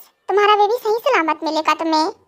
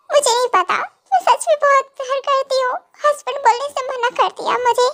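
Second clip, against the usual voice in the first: higher-pitched, distressed lovelorn voice; anguished confession; 5 semitones higher than usual. Acted. Woman admits she loves the husband she left, anguished. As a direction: pour out the heartbreak in a raised pitch. Confesses love after painful breakup.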